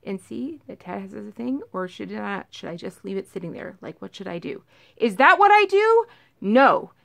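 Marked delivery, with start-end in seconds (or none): 0.0-4.6 much softer, half-whispered polite request; the sensible quiet way to ask for help; calm phone call. Pretend. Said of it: "and be like".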